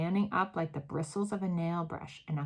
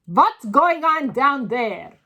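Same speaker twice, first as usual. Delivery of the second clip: slow, loud, higher commanding shout; 6 semitones higher, 10 dB louder, slower than usual. A narrator's reading. Grand High Witch demanding from the platform, slow loud shout. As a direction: bellow it down from above. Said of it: "shouted the Grand High Witch".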